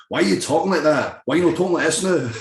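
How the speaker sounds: high, loud, broad Scottish heckle; thick Scottish accent. Retold or imitated. Scots bringing him back down to earth, indignant Scottish accent. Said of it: "I had Scotland to bring me back down to earth".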